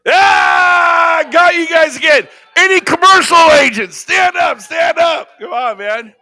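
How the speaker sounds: high, loud shout to the room; rally them to their feet; stage shout at the audience; calling out to the crowd. Real, not acted. Calling commercial agents to stand.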